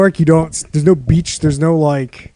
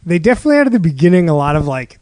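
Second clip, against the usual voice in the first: ordinary delivery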